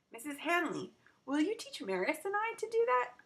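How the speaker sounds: put-on a kid hoping for a yes; eager girl. Child asking eagerly.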